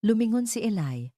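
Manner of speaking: plain reading voice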